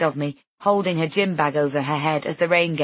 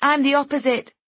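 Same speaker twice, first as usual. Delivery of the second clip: high, moderately raised call; yelling through a door; 9 semitones higher, 4 dB louder than usual. A narrator's reading. Guest answers through closed door, yelling lightly. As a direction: project it high to the next room. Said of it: "yelling through the closed door".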